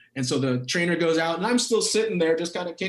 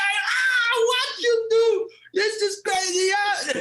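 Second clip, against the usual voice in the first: put-on very high, exasperated accented yelling; imitated exasperated shout; slower than usual. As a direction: throw up your hands and shout like the coach. Coach yelling at seated player.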